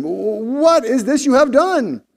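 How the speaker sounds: high, loud, drawn-out question; fearful dismay. A narrator's reading. Frightened sailors confront Jonah, fearful dismayed cry. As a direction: cry out in appalled alarm. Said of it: "were exceedingly afraid and said".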